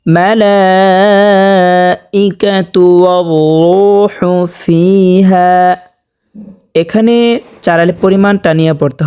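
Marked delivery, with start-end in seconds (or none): 0.0-5.5 slow chanted tajweed recitation; Quran recitation. Shown as a demonstration. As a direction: recite slowly, stretching each sound. Recites verse with elongation.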